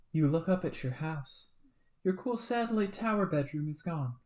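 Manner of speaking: low, soft narrator read; quiet storybook narration; story narration. A narrator's reading. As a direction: narrate calmly to the listener. Reads game narration.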